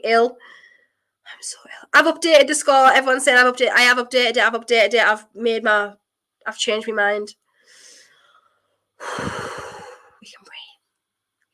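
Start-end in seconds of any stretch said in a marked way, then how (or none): 1.3-1.9 whisper. Real, not acted. Exhausted streamer after the win. 10.2-11.5 whisper. Real, not acted. Relief as team goes through.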